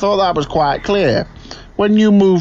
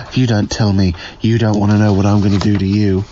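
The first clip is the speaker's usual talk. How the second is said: much lower, faster villain threat; menacing threat; 8 semitones lower than usual. Acted. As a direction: growl the threat low.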